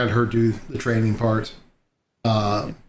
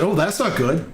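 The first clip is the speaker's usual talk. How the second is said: higher, mock-concerned speaker voice; assistant voice; 4 semitones higher than usual. Man quoting his smart speaker's cheeky reply, mock concern. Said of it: "it was like".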